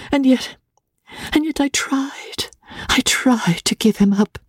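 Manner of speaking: high-pitched, plaintive confession; remorseful bride, fading at the end; timid young wife, guilt-ridden. A narrator's reading. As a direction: blame yourself in a high, fragile voice. Voices Emmy's anxious thoughts.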